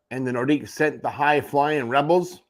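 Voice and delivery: ordinary delivery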